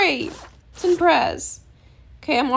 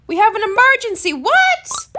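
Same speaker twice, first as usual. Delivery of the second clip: loud, high mock alarm; playful alarm; 6 semitones higher, 6 dB louder than usual. Gamer learns of an emergency, playful panic. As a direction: raise the alarm, shooting up in shock.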